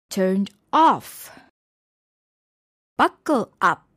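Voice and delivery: turned OFF, buckle UP, particle punched loud; stress on particle. Shown as a demonstration. Teacher showing phrasal verbs, particles stressed in "turned off", "buckle up". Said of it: "the stress is on the particle".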